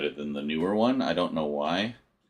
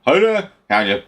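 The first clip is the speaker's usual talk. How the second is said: loud announcer greeting; 7 semitones higher, 11 dB louder than usual. Shown as a demonstration. Mock announcer mic test.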